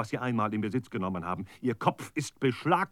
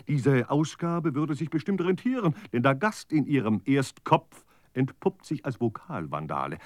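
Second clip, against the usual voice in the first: ordinary delivery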